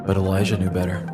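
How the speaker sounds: ordinary delivery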